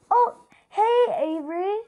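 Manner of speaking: high, bright pretend customer greeting with a laugh. Girl playing shop greets the shopkeeper, high customer voice. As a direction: squeak out a friendly customer hello.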